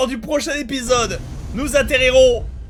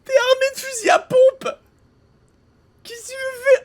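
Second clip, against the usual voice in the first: much higher, disbelieving shout; high incredulous rant. Real, not acted. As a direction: squeal it in disbelief. Ranting at game character.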